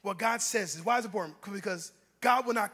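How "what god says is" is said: normal talking voice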